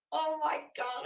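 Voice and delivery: normal talking voice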